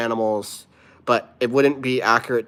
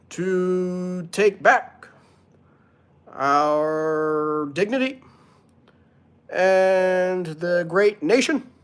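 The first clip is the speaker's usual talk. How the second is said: higher, louder, very slow presidential cadence with long pauses; hammed-up Obama speech, higher and louder; Obama impression; 7 semitones higher, 5 dB louder, slower than usual. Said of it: "Let's do a B-, Obama impression".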